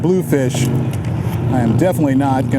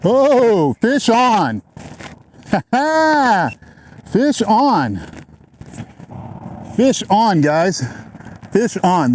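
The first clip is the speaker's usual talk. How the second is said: excited; 7 semitones higher, slower than usual. Real, not acted. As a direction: shout it out as the fish bites. Another fish hooked.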